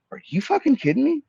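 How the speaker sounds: high-pitched, incredulous outburst; retold outraged disbelief. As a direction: react in sharp disbelief, pitch leaping up. Retelling his shocked reply to the denial.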